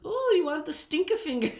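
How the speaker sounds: high, giggly imitation of a shop assistant; giggly saleswoman. Saleswoman teasing a customer about the novelty finger, mock giggly. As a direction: pitch it up like an amused saleswoman. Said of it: "she just kinda giggled and she goes".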